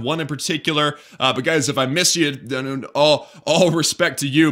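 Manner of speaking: ordinary talk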